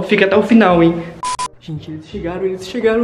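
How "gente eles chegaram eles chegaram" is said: hushed. Real, not acted. Hiding as they arrive.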